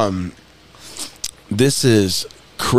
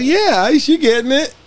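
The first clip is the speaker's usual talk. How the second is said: high mock voice; 11 semitones higher, slower than usual. Quoting a guy, high voice.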